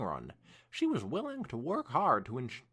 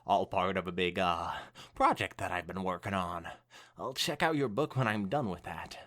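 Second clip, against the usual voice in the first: lower, hurried cover story; nervous Twilight; 11 semitones lower than usual. Acted. Twilight covering to Rainbow, lower nervous bluff. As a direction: bluff your way through. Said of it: "about to start sweating".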